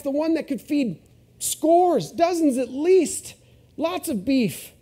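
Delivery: high, loud, excited orders to servants; father overjoyed, calling to servants; joyful father calling out. Retold or imitated. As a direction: shout for the robe and ring in delight. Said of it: "doesn't even let him finish".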